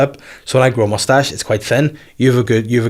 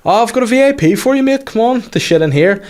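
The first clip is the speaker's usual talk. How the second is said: mimicked toilet attendant; 11 semitones higher than usual.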